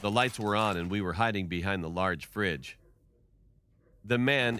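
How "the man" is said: usual speaking voice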